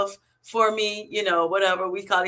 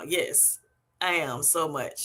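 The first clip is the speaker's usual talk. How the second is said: ordinary talk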